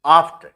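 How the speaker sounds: AFT punched out loud; loud, isolated AFT as a stress demo; stressed first syllable. Shown as a demonstration. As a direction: hit AFT with extra breath force. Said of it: "यहाँ पर stress mark है".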